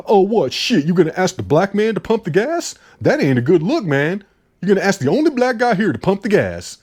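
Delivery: mock offended. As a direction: put on an outraged complaint. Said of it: "fake offended".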